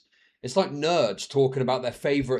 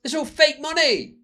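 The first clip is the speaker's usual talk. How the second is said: high, whiny, know-it-all voice; squeaky smug teen conspiracy voice; mock naive lefty; 13 semitones higher, 7 dB louder than usual. Streamer parodying a naive teen, high and whiny. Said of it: "Do you remember that one".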